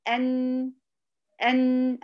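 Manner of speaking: the nun in أن held steady with still lips; held nun sound. Shown as a demonstration. Teacher showing the held nun of أن to a student. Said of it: "أهو ثبات الشفتين والثبات".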